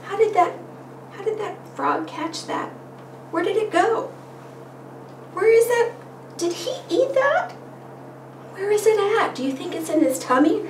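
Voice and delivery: high, wondering storytime questions; questioning a child. Shown as a demonstration. Parent asking where the fly went, high and wondering. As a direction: pitch it up in curious wonder for a little one. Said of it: "you go back and you say".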